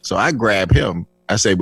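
ordinary talk, nothing marked

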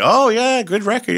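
high, loud excited praise; enthusiastic praise. Retold or imitated. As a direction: gush over the record. Studio staff praising.

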